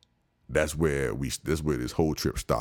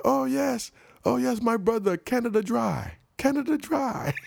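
hammed-up street hawker. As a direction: mimic the hawker proudly shouting the brand. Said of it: "So he was like".

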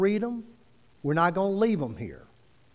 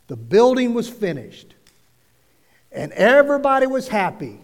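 loud, high preacher's crescendo; emphatic preaching; 7 semitones higher, 9 dB louder than usual. Acted. Preacher telling church the troubles were over, emphatic and loud. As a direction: belt out each phrase to the room.

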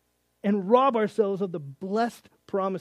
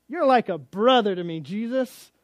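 higher, louder awkward letdown; Peter friend-zoning; 4 semitones higher, 6 dB louder than usual. Retold or imitated. Peter tells Jesus he's like a brother, mock awkward. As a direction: let him down gently, a bit loud.

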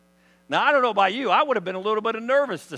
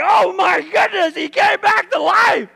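high, slow, loud amazed yell; hammed-up cry of stunned amazement; astonished shout; 13 semitones higher, 12 dB louder, slower than usual. Disciples see Lazarus alive, astonished shouting.

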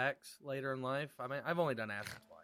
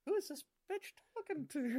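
incredulous; 12 semitones higher, 5 dB quieter than usual. Retold or imitated. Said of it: "looking around like".

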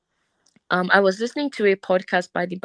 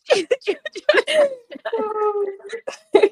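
very high sob mixed with laughter; real laugh dissolving into crying; tearful laugh-cry. Real, not acted. Overcome while telling of eternal life, laughing and crying. Breaks down mid-confession.

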